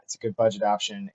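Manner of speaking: ordinary talk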